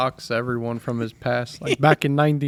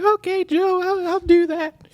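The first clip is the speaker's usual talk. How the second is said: very high, slow, timid agreement; meek imitation of Lee; 4 dB louder than usual. Lee meekly agreeing to Joe's demands, high and timid. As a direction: squeak out a meek yes. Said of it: "he's like".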